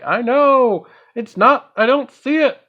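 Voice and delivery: high, loud, hammed-up helpless wail; exasperated protest. Player answering screaming viewers, exasperated mock protest. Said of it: "And I'm like".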